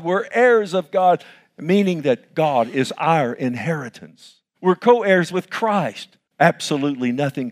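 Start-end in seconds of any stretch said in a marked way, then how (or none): none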